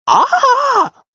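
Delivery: a sudden very high, loud cartoon shriek; very high, loud startled yelp; villainess shriek. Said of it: "from the rescuers".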